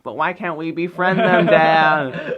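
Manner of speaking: loud, high pleading whine; mock nasal whinge; Hiccup whining. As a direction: imitate a whiny boy begging his father. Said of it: "He's really whiny".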